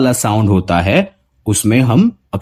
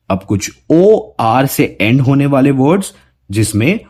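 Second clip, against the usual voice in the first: ordinary talk, nothing marked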